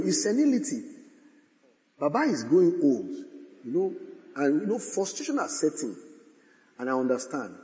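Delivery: softly voiced pitying neighbours; hushed mock sympathy. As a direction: murmur it like concerned, condescending well-wishers. Voicing pitying neighbours praying.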